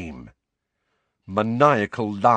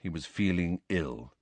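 plain reading voice

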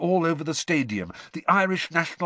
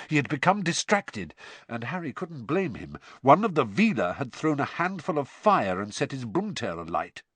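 ordinary delivery, nothing marked

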